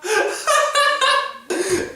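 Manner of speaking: high, loud laughter through words; laughing hard. Real, not acted. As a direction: crack up while talking. Cracking up at the scene.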